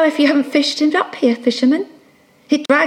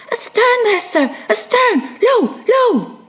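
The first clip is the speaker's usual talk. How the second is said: crying out; 6 semitones higher than usual. Acted. Pip pointing astern for Ahab, high wailing call.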